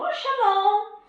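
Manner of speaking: high, loud startled hello; boy greeting the stranger, caught off guard; startled child greeting. Acted. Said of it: "e ele falou". (saudação de menino assustado, aguda e alta)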